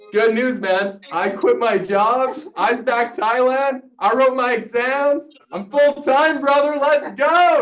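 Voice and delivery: slow, high, building to a loud peak; mounting hype that bursts at the end; hyped announcement. Retold or imitated. Acts his excited announcement to mentor.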